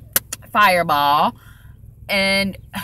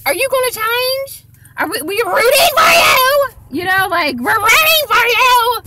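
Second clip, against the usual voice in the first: much higher, loud pleading whine; hammed-up crying plea, pitched way up; mock Peter Mon; 14 semitones higher, 7 dB louder than usual. Mocking Peter Mon pleading with Tana, loud and high. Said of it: "I'm like Peter Mon with Tana".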